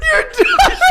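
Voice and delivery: squeezed out through a high laugh; words drowned in real laughter. Real, not acted. Dad laughing, retelling toddler story.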